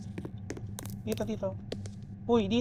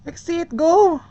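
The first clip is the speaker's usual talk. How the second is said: urgent shout; 7 semitones higher, 8 dB louder, slower than usual. Real, not acted. Panicked escape in horror game.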